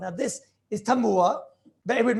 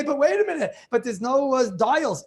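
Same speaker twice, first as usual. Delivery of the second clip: a voiced objector, pitched higher and louder; higher, louder mock protest of a listener; mock objecting listener; 5 semitones higher, 4 dB louder than usual. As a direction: play the clever student who thinks he found a loophole. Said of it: "But you're gonna say".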